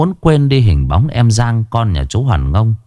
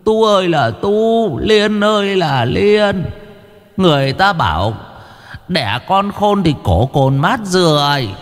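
put-on old woman scolding; 7 semitones higher than usual. A narrator's reading. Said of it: "mà quát".

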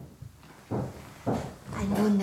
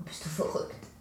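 geflüstert; 5 dB quieter than usual. Acted. Ehekrach um Geld, geflüstert.

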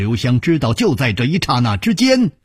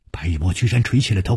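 ordinary delivery

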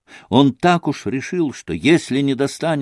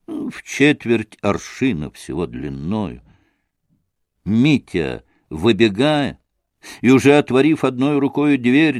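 ordinary delivery, nothing marked

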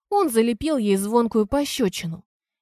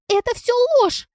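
piercing, very high and loud denial; frantic high-pitched screech; shrieking; 5 dB louder than usual. A narrator's reading. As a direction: squeal it out in outrage. Лизка jumps up, shrieking denial again.

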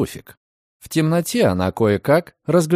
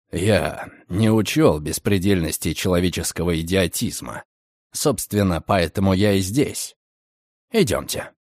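low calm male voice; 6 semitones lower than usual. A narrator's reading. Redfern dry. (низкий спокойный голос)

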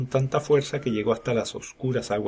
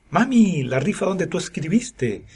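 put-on higher-pitched lively child voice; child calling mom; 7 semitones higher, 3 dB louder than usual. A narrator's reading. Boy pipes up to his mother, eager childlike call.

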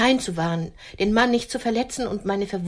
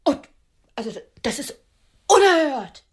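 indignant outburst; 2 semitones higher, 3 dB louder than usual. Acted. Outraged at the insult.

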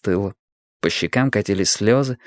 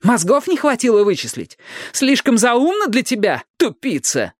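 high-pitched contemptuous jeer; venomous high sneer; scornful insult; 12 semitones higher than usual. A narrator's reading. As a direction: taunt them for being stupid. Pippa sneering insults at Strike.

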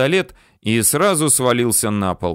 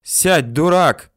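higher, louder scolding cry; raised, urgent narrated shout; 7 semitones higher, 4 dB louder, slower than usual. A narrator's reading. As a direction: yell a warning at a fool. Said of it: "закричала Людмила".

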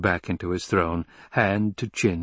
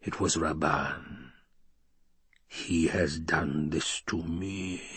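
softer, slow inner voice; inner thought; 4 semitones lower, 7 dB quieter, slower than usual. A narrator's reading. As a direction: think it to yourself, hushed and slow.

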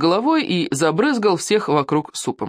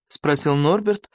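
ordinary delivery

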